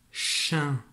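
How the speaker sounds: "chien" said alone, clear and falling; dictated syllable. Shown as a demonstration. Teacher dictating a syllable, clear. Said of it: "pour écrire la syllabe".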